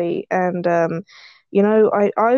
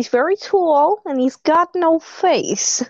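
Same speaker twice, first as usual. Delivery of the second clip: mocking little-girl voice; 6 semitones higher than usual. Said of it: "I was making fun of her voice".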